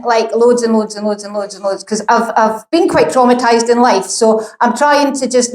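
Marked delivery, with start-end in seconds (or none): none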